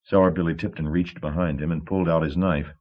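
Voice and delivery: plain reading voice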